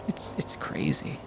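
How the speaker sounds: soft unvoiced whisper. Real, not acted. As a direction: whisper it under your breath. Muttered aside about price.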